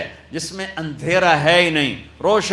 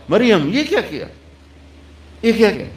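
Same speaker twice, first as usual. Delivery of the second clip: raised, scandalised "what have you done"; accusing crowd; 6 semitones higher than usual. Acted. Crowd shouting at Maryam in shocked reproach.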